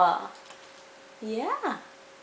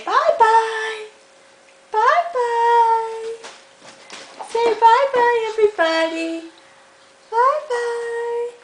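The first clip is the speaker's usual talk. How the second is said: high slow sing-song goodbye; high baby-talk bye-bye; 8 semitones higher, slower than usual. Acted.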